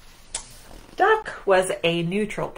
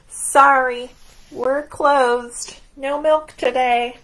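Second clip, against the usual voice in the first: louder, higher, matter-of-fact; strike sign; 6 semitones higher, 6 dB louder, slower than usual. Acted. Cows posting a notice, loud and bright. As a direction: announce the strike proudly.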